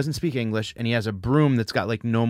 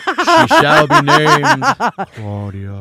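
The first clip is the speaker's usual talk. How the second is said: much higher, slow, louder with a laugh; grand proclamation; 9 semitones higher, 9 dB louder, much slower than usual. Pretend. Mock-announcing her God-given name, slow and high. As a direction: proclaim it like a heavenly voice. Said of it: "proclaiming God naming Claudia".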